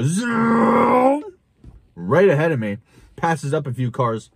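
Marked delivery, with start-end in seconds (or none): 0.0-1.2 high, drawn-out zoom; slow high whoosh of a passing car. Retold or imitated. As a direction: stretch it like a car speeding past. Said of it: "I hear him go".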